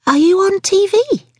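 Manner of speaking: very high-pitched, incredulous ask; astonished question. Acted. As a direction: find out he's famous, amazed. Waitress astonished at celebrity.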